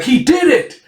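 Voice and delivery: very high, excited crowd cry; acted whoop, pitched right up; excited cheer. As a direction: celebrate a sudden capture. Villagers cheer a capture.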